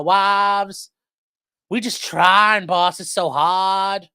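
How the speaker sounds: hammed-up Southern cop begging the court, boss; mock Southern police accent. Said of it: "I was doing more of a John Coffey accent".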